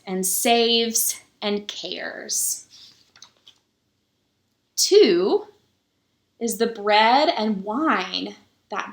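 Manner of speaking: slow, measured picture-book reading with pauses; story reading. A narrator's reading. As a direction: read it to the children slowly, lingering on each number. Reads the picture book aloud.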